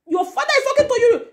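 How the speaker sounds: very high mock scolding; high, strained acted rebuke; imitating a scolding mother. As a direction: call the child to order, pitched right up. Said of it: "somehow block and say".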